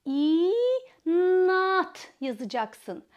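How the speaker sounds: İnat yazacaksın said very slowly and clearly; dictating. Shown as a demonstration. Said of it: "kelimeyi bir bütün halinde söylüyoruz".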